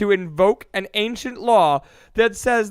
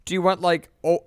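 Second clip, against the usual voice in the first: normal talking voice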